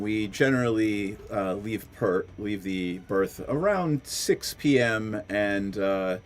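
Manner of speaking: a lightly voiced haughty lady, low and measured; a hint of a cool gatekeeper, unhurried; haughty noblewoman.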